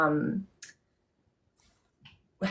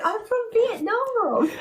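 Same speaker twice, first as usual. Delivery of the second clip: put-on much higher, slow English accent; mock English accent; 10 semitones higher, slower than usual. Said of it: "English accent?".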